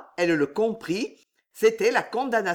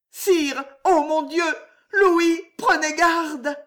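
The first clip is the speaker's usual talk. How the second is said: higher, louder urgent cry; breathless alarmed call; alarmed outcry; 11 semitones higher, 5 dB louder, slower than usual. Acted. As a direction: cry out to warn him. Juliette alarmed, cries out.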